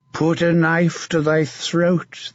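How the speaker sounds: a mad, leering knight taunting his captive; sinister, mocking menace; a twisted zealot savouring the moment; creepy villain. Sir Martin quotes scripture.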